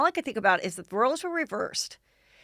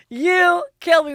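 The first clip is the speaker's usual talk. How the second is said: high, slower, louder, punching you; imitating Matt laughing harder at nothing; mock laughing; 10 semitones higher, 8 dB louder, slower than usual. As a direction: drag it out through the laugh. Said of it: "he laughs really hard at things".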